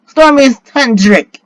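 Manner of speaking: slow, high, loud incantation; spell call. A narrator's reading. Boy casts a storm spell, slow loud call.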